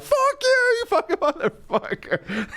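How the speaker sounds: very high mock yell at Gilbert; hammed-up furious heckler yelling back; imitating angry heckler. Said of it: "They were so mad".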